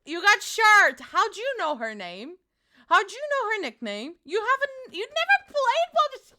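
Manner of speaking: rising excited protest; squealing at chat's knowledge; excited disbelief. Real, not acted. Excited reaction to chat.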